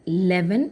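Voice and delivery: "Eleven" starting straight on L; wrong pronunciation. Shown as a demonstration. Teacher warns students, "Eleven" without its opening vowel. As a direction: say "Eleven" as leven, first vowel dropped. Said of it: "ല് ആണ് നമ്മൾ start".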